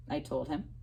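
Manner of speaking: ordinary delivery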